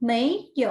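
"ไม่ 有" in two separated syllables; clear enunciation. Shown as a demonstration. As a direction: model "ไม่ 有" distinctly, pausing between the syllables. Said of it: "จะต้องออกเสียงให้ชัดถ้อยชัดคำทุกพยางค์".

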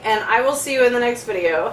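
normal talking voice

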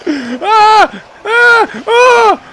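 high, loud repeated cries; outraged yelps. Real, not acted. Player just shot by teammate, high yelps. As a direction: cry out in shocked protest.

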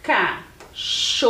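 CACHORRO slowly broken into three separate syllables. Shown as a demonstration. Teacher assembling the word for pupils, cachorro syllable by syllable. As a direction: say cachorro as ca, cho, rro with clear pauses. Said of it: "E formamos a palavra".

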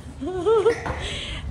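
bright laugh rising in pitch; high amused laugh. Real, not acted. Amused by son's drawing.

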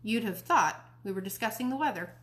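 usual speaking voice

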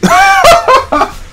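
high shrieking laugh; sudden high cackle. Real, not acted. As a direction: squeal with laughter. Laughing at a near miss.

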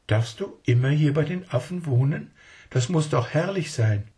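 put-on high, eager little child asking its uncle; a small child not believing a word; child's voice. Child asks the woman.

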